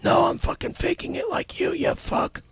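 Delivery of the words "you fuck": ordinary talk, nothing marked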